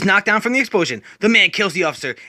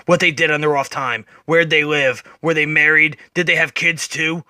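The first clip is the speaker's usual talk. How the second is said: deep, low, brooding Batman voice; dark lowered hero voice; Batman narrating; 6 semitones lower than usual. Acted. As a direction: growl it low like a weary vigilante. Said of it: "Batman then narrates".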